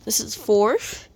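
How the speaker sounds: ordinary talk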